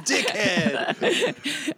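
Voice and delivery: high squeal through laughter; laughing. Real, not acted.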